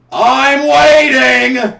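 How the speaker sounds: loud, slow, mocking impatience; loud sarcastic call. Real, not acted.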